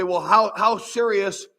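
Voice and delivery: ordinary delivery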